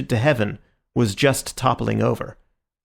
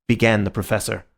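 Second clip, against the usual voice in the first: ordinary delivery, nothing marked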